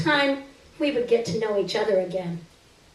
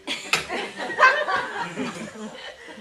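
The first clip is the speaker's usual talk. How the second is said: breathy laugh; 10 semitones higher, 3 dB quieter than usual. Real, not acted. Reader cracks up during recording.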